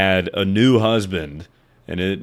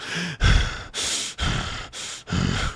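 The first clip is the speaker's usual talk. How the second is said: a long, airy, higher sigh, loud breathing shown; 8 semitones higher, 5 dB quieter than usual. Shown as a demonstration. Streamer showing her loud focus breathing, a heavy airy sigh. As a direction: breathe out heavily like when focusing. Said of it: "so I'm not just like".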